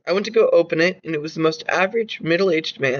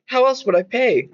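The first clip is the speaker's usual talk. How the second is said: raised, anxious question; higher pitched question; 8 semitones higher, 4 dB louder than usual. Acted. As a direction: ask it higher and more urgent. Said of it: "I asked".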